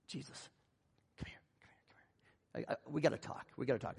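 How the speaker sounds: secretive whisper opening into low murmur; acted confidential whisper; hushed summons. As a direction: pull someone aside, barely audible. Acting Peter pulling Jesus aside privately.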